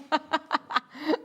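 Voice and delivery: helpless giggles at a typo; real fit of giggles; amused laughter. Real, not acted. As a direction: burst out laughing. Amused by the misprinted name.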